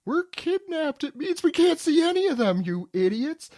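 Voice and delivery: put-on high snotty kid scolding others; high bratty kid. Recapping manga to friends, bratty kid Colin sneering high. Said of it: "that was Colin".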